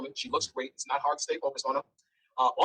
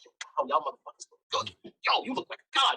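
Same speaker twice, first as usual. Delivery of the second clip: higher-pitched mock admiration; imitated gushing compliment; 10 semitones higher than usual. Man complimenting two naked people on a balcony, retold gushing. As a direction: lightly act out an impressed man's praise. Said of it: "doing all of this complimenting".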